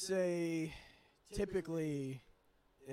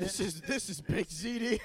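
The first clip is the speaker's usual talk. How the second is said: slow, higher, louder comic indignation; stretched-out mock dismay; mock outraged parent; 5 semitones higher, 6 dB louder, slower than usual. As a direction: protest like a hammed-up horrified parent. Mock horrified parent in hospital.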